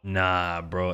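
usual speaking voice

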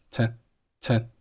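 "to" reduced to a soft schwa; short, unstressed "to"; weak "to". Shown as a demonstration. Teacher demonstrating the weak form of "to". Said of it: "to是弱读".

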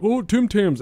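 high and loud squeal of the snack name; excited mock call. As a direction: shriek it like a fan spotting treats. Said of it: "people will be like".

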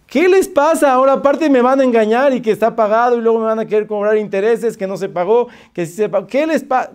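high, breathless indignant rant; angry yelling. Retold or imitated. Customer accusing office of cheating, yelling angrily. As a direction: let the accusations pour out in outrage. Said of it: "no me grite".

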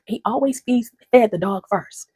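ordinary talk, nothing marked

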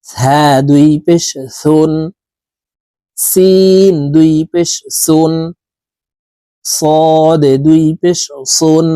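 slow, drawn-out Quran letter recitation; slow recitation drill. Shown as a demonstration. Teacher drilling students in slow tanween recitation. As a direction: chant each letter slowly for students.

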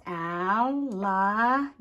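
"Alligator" with pauses between al, li, ga and tor; one sound group at a time. Shown as a demonstration. Teacher laying down crayons, "Alligator" in separate syllables. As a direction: isolate each syllable of "Alligator". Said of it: "Let's lay down our crayons".

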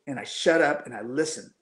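usual speaking voice

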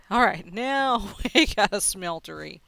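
loud, slow, laughing through it. Real, not acted. Player finally finishing the smeltery, loud laughing delivery. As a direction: say it while laughing with relief.

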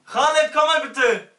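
loud, slow, high call. Acted. Friend calling Khaled upstairs, loud slow call. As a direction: call him over from the other room.

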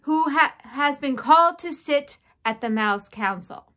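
loud, high proclamation; shouted announcement. A narrator's reading. Furlow presenting his brother to the crowd, shouting. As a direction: announce it proudly to a whole hall. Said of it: "shouted Furlow".

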